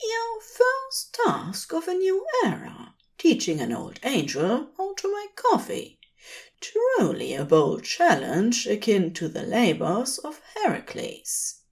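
fussy angel overjoyed, pitch soaring; delighted angel. Acted. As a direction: tease him with a grand mock-heroic flourish. Aziraphale delighted, voice catching.

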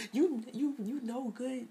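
high, stammered mock sobbing. Imagining a heartbroken Jennifer stammering, mock sobbing. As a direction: stutter it like a hammed-up sob, high. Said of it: "like, crying and".

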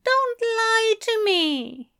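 high, slow, offended scoff; high-pitched indignant reproach. A narrator's reading. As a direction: scold a friend in a high voice. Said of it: "The angel scoffed".

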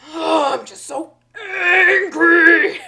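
loud, high, drawn-out tantrum; mock growling. Teacher imitating a boy at the back of class, growling anger. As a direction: strain and growl through a slow hammed-up rage. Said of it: "he's growling at his books".

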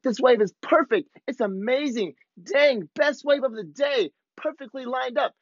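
lightly acted stoke of a friend cheering; mild mock hype of a surfer buddy cheering on a wave; imitating excited buddy.

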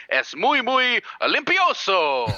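high, slow mock-Spanish flourish; Letterman catchphrase. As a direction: ham up the late-night host's gag. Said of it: "And so he would say".

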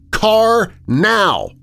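high, loud barked scream. Acted. James screaming at Adeline for a car. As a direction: yell the order and slam it down. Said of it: "I scream at her and hang up".

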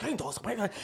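a hint of the coach's choppy broken English; mimicking the coach's cadence. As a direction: lightly repeat it in his rhythm. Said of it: "you got the cadence down".

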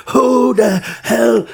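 long-drawn high mock shout; angry girlfriend. Girlfriend holding the card, furious drawn-out demand. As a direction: yell each word slowly, imitating outrage. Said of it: "she's so angry though".

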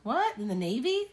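high shocked "What?"; surprised. Real, not acted. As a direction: gasp it out in disbelief. Shocked reaction.

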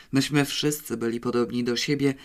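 ordinary delivery, nothing marked

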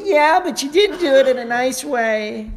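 high, loud prissy scold; mock critic. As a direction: imitate a critic's tut-tut. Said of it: "they say".

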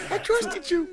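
very high, hammed-up wail; mock crying. Podcaster mimicking friend who was let fall, mock tearful wail. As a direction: wail like a betrayed friend, played for laughs. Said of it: "He starts crying".

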